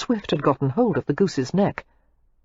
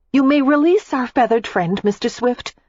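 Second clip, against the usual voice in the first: louder, higher proud announcement; triumphant; 8 semitones higher, 4 dB louder than usual. A narrator's reading. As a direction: declare it grandly. Triumphant Daisy continues.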